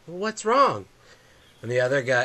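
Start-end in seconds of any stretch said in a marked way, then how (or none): 0.1-0.9 put-on high child's question; worried little boy. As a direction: ask it like a nervous kid. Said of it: "the first kid goes".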